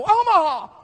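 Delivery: very high, loud eureka cry; excited shout. Pretend. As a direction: burst out with a sudden realization. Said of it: "wake up tonight and go".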